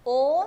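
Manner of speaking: ओ as a pure long "oh"; careful teacher's model of ओ; demonstrated pronunciation. Shown as a demonstration. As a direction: say ओ like the English letter O, with no glide. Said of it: "मेरे उच्चारण पर ध्यान दें".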